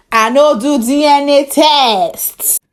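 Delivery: slow, high, loud refusal; David refusing the host, slow and defiant. Acted. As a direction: refuse flatly and slowly.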